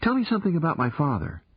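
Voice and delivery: put-on fast, eager demand from a girl; insistent young girl. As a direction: press him quickly, wanting to know. Girl presses for answers.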